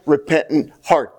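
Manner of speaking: normal talking voice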